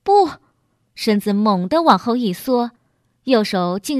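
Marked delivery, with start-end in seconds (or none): 0.0-0.3 loud, high cry of refusal; short burst of a frantic shout; sudden wild cry. A narrator's reading. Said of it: "小乐忽然狂叫一声".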